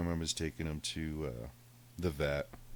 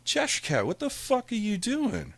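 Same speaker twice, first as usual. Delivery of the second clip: exasperated yell; 6 dB louder than usual. Retold or imitated. Owner catching his cat peeing in shoes, outraged yell. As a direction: shriek at the cat in disbelief.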